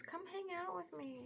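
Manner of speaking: a hint of the cat inviting her; lightly voiced cat. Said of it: "She says".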